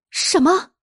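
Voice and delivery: high, astonished outburst; startled question. Acted. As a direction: cry out in disbelief. Household baffled by the revelation.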